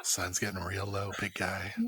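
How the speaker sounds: low, soft calming voice; mock soothing. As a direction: talk down a raging giant, playfully. Mock soothing of angry character.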